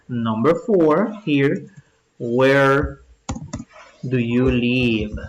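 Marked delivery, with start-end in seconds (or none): 2.2-5.2 "Where do you live?" drawn out word by word, with a pause after "Where"; slow dictation. Shown as a demonstration. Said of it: "Escribamos eso, pues". ("Where do you live?" dictado muy despacio)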